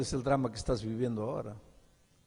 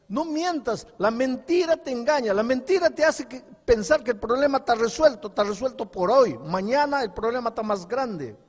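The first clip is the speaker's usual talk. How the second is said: higher and louder, pressing each line; fiery preaching; 9 semitones higher, 9 dB louder than usual. Acted. Pastor warns the congregation about lies, fiery preaching. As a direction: preach it hard, warning the flock.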